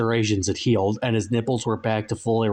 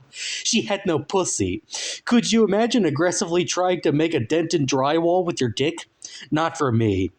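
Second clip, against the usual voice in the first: higher, laughing falsetto anecdote; Safari Man voice; 7 semitones higher than usual. A narrator's reading. Safari Man tells of his marriage, higher. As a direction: brush it off with a cackle.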